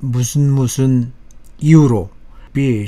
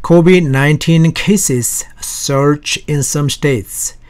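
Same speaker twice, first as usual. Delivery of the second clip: slow reading; 3 semitones higher, 3 dB louder, much slower than usual. Shown as a demonstration. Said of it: "Headline is".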